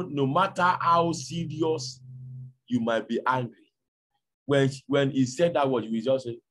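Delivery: usual speaking voice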